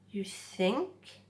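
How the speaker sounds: plain reading voice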